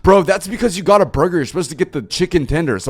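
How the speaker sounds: imitating a friend defending Whataburger; defensive friend. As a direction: rush to defend the chicken tenders. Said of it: "My friend was so defensive".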